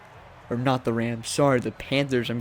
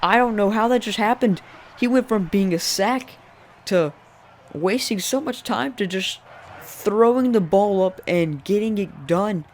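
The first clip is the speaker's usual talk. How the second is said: disbelief; 7 semitones higher, 3 dB louder than usual. Real, not acted. Disbelief at the play.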